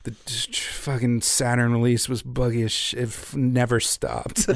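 low, soft, worn-out developer voice; weary developers. Retold or imitated. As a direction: sound exhausted from crunch. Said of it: "they were like".

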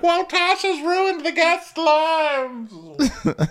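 very high, slow laughing mock voice; laughing squeaky guests. Guests complaining in a very high, slow, giggly mock voice. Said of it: "They're like".

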